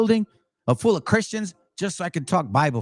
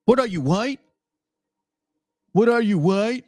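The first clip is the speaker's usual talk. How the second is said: higher, mock-incredulous question; mocking quote; 4 semitones higher than usual. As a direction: imitate a scoffing challenge. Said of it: "even our gente tells me".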